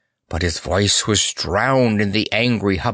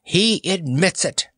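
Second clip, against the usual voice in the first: high-pitched crowing; triumphant; 10 semitones higher than usual. A narrator's reading. Courtier announces the clown's confession, high and triumphant. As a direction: crow it high to the whole court. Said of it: "exclaimed Panapee triumphantly".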